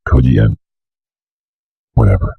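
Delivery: ordinary talk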